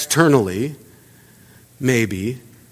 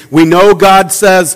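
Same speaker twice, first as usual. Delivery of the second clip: ordinary delivery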